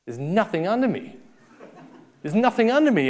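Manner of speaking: fear building on the second one; panicky climber. Retold or imitated. Scared climber on the cliff, rising panic. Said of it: "And you're going".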